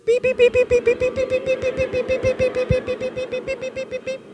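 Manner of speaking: high, rapid alien beeping; alien beeps. Shown as a demonstration. Leading the room as aliens, high rapid beeps. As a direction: chatter like a tiny alien. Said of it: "two, three".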